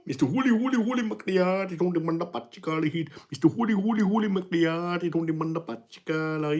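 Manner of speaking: high, sing-song mock Indian accent chant; Indian accent impression. Chat requests an Indian impression, streamer chants in mock Indian accent. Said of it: "Do a good Indian impression".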